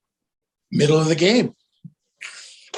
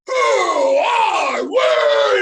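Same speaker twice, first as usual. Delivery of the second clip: long, high, loud bellow; slow, climactic rallying holler; drawn-out cheer call; 6 dB louder, much slower than usual. Retold or imitated. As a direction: stretch each word into a huge shout. Last, biggest cheer call.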